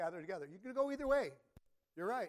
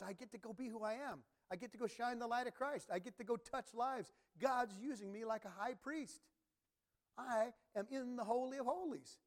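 lifted, bright-eyed eagerness, a little higher; joyful eager believer; 7 semitones higher than usual. Retold or imitated. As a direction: sell the joy of getting to go.

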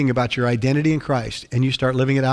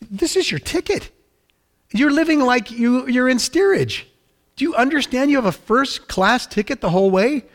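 high-pitched, disbelieving insistence; incredulous captain; 7 semitones higher than usual. Acted. Captain telling a steerage passenger he holds first class, incredulous. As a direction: play the captain amazed he doesn't know.

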